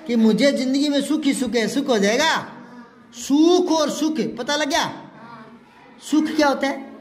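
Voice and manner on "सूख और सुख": सूख और सुख said slowly, the long ऊ of सूख set against the short उ of सुख; teacher contrasting सूख and सुख slowly for the class. Shown as a demonstration. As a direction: spell out the vowel difference slowly. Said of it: "छोटी मात्रा, बड़ी मात्रा का फर्क".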